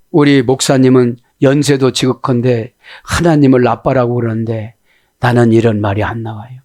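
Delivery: ordinary talk, nothing marked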